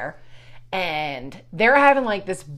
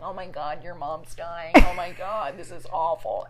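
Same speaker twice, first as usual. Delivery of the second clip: hushed dread; 4 semitones lower, 6 dB quieter, slower than usual. Retold or imitated. Muttering, panicked, among kids.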